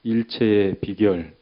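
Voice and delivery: loud, slow line for repeating; slow, forceful pulpit prompt; congregational call-out. Real, not acted. Leads congregation in repeat-after-me.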